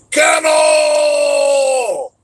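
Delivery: put-on long drawn-out drunken yell; yelling as loud as possible. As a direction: bellow it across the deer camp. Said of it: "Yelling "Kennel" as loud as they could".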